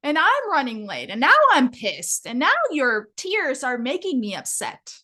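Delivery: hurried mom snapping at her daughter over ice cream; impatient scolding mom. Retold or imitated. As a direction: scold a crying child while in a hurry. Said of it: "my mom looked back and said".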